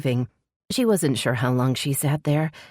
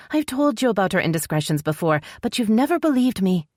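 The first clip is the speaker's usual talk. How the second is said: higher female voice, frustrated and accusing; higher voice pressing her point; Esrel, frustrated sister; 7 semitones higher than usual. A narrator's reading.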